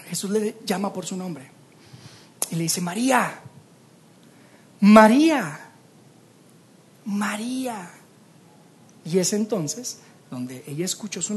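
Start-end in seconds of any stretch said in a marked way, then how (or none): 2.8-7.9 Jesus tenderly calling María, the last time in a whisper. Acted. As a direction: call her gently, the last one only breathed. Jesus calls her by name.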